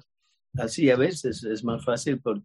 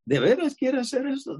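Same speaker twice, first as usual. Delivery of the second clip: surprised question; 12 semitones higher than usual. Retold or imitated. Man's surprised reply quoted.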